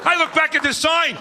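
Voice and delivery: ordinary talk, nothing marked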